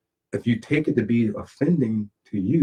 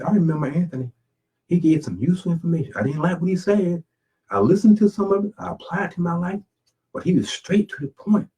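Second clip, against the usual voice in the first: higher-pitched imagined admirer; people reminiscing; 6 semitones higher than usual. Acted. People remembering him after his time, lightly acted higher voice. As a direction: lightly voice someone looking back on him.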